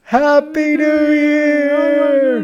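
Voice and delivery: high, slow drawn-out cheer; excited cheer. Real, not acted. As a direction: stretch it out in celebration. Midnight countdown ends.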